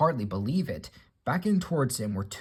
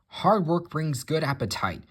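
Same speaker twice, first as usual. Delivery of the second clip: a mild, higher snap back; snapping; 6 semitones higher, 4 dB louder than usual. Acted. Gordon retorts to James, mild snap. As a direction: snap back with a touch of pride. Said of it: "snapped Gordon".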